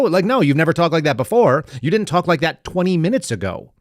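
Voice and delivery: ordinary talk, nothing marked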